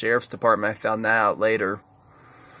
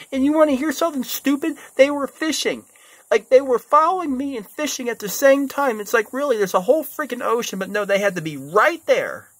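exasperated rant; 12 semitones higher than usual. Real, not acted. Ranting about fishing kayakers.

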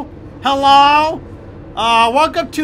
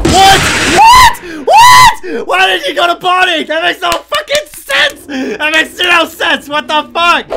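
much higher, much louder, outraged; shocked yelling; 9 semitones higher, 9 dB louder, slower than usual. Real, not acted. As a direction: shriek in disbelief. Monster went the wrong way.

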